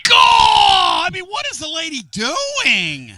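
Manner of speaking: loud, high, drawn-out holler; high-pitched incredulous bellow; mock-outraged shout. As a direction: shout in exaggerated disbelief, stretching the last word. Mock outrage at caller's story.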